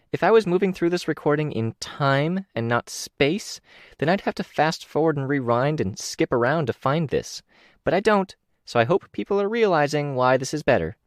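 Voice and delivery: a hint of a bubbly kid showing off; young girl's voice. Kenzie's voice, narrator reading.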